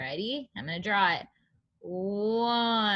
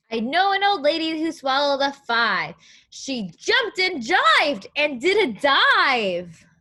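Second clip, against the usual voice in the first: lilting, louder read-aloud rhyme; storybook reading; 3 semitones higher, 6 dB louder than usual. Acted. Teacher reading the rhyme to young children, sing-song. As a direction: read the rhyme like a picture book to kids.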